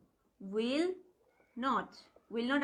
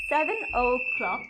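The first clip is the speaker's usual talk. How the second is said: slow explanation; 6 dB louder, much slower than usual. Shown as a demonstration. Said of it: "আমি তোমাদের বলে দেই".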